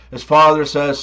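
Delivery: normal talking voice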